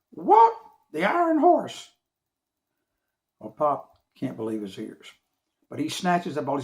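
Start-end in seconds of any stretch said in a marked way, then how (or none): 0.1-1.9 very high, loud bird squawk. Acted. Bird squawking at the name Babe Ruth. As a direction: screech it like an amazed parrot.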